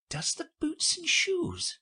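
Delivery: put-on high, slow, puzzled girl echoing "Does the boots and shoes?"; wondering. A narrator's reading. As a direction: lift "Does the boots and shoes?" into a bewildered question. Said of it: "she repeated in a wondering tone".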